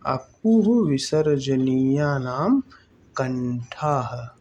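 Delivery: slow, word by word for writing down; slow dictation. Shown as a demonstration. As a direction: spell out अकुह विसर्जनीया नाम कंठाः slowly so students can write. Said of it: "क्या आप भी लिखेंगे मेरे साथ".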